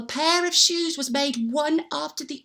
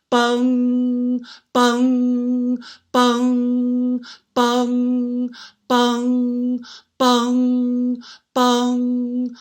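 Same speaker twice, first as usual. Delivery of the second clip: slow, even, ringing bung for each stroke; clock chimes; 3 dB louder, much slower than usual. Acted. Telling the clock's chimes at midnight, ringing and even.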